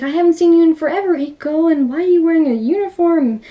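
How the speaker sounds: high, animated reunion chatter; acted gushing surprise. Tomoko greeting Iko after forever, acted excited surprise. Officer gushing at long-lost friend.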